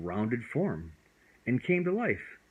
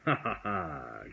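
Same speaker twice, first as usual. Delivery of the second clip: playful chuckle; 4 semitones lower than usual. A narrator's reading. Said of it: "Puff chuckled playfully".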